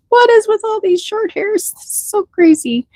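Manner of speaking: high, baffled husband voice; puzzled husband. Retold or imitated. Husband puzzling over short-haired bunnies, high baffled voice. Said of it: "My husband would go in the bunny barn and goes".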